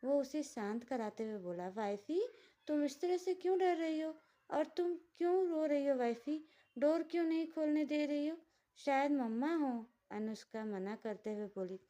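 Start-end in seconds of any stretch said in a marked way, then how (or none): 1.7-9.8 put-on higher, childlike voice full of worry; a simple-hearted man fretting over his crying wife; childlike worried husband. A narrator's reading. Lakshya asks his crying wife what happened, childlike and worried. Lakshya puzzled by her fear.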